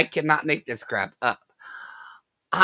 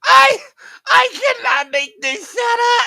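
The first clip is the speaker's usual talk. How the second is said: high, shouted through laughter; can barely get it out for laughing; laughing disbelief; 8 dB louder than usual. Real, not acted. Streamer to chat, laughing too hard to talk.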